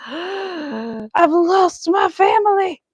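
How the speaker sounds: high, slow, hammed-up wail of a lost puppy; mock sob. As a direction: wail it like a heartbroken little dog. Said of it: "he'd be like".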